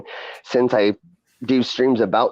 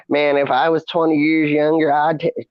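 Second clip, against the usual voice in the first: imitation Mississippi gentleman's accent, pitched up; Mississippi Hickerson accent; 5 semitones higher than usual. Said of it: "I was doing the Hickerson accent".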